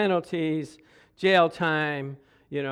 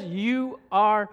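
slow, drawn-out declaration; the Lord declaring forgiveness, slow; slow proclamation; 4 semitones higher, 4 dB louder, slower than usual. Acted. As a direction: lay each word down slowly. God's words, slow.

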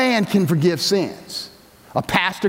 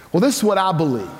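ordinary talk

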